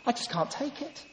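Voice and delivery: high, strained mock despair; a believer at the end of his rope; pleading upward in distress; anguished plea. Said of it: "he says".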